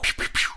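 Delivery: quick mouth-made "pew" shots; imitated gunfire. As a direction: imitate shots fired from the shadows. Imitating the gunshots from the shadows.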